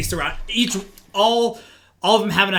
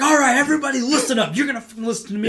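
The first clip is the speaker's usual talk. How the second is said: higher-pitched mock call to order; taking charge; 7 semitones higher, faster than usual. Dee rallying the arguing gang, retold as taking charge. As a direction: imitate someone cutting through an argument.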